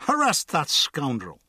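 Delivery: pompous order to arrest; drunk accuser. A narrator's reading. Drunk demands the constable arrest the guard, pompous. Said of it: "he said, pointing to the guard".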